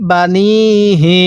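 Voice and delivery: বানিহি, slow, with the নি vowel stretched; drawn-out nun. Shown as a demonstration. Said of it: "নুনের মধ্যে টান দিব".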